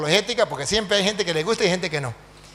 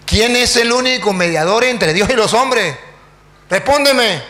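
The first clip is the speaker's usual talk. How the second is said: loud, raised, slow challenge; forceful demand; 3 semitones higher, 5 dB louder than usual. Retold or imitated. Priest cornering the silent pastor before everyone, demanding. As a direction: press hard for an answer.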